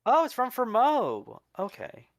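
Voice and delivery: ordinary talk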